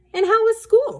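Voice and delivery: high, bright, loud motherly question; sweet mother voice. Acted. Mother greets daughter home from school, sweetly high. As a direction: ask like a doting mom greeting her kids. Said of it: "she asked Wendy".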